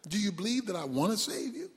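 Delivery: quick, soft, low question; lightly voiced, unruffled God; voice from heaven. God testing the hanging man, low soft voice. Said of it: "The voice said".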